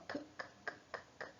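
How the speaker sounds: "k" as a bare voiceless click, repeated; phonics sound. Shown as a demonstration. Teacher showing the children the sound of the letter k, unvoiced. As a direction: tap out "k, k, k" with no vowel. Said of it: "Olha só".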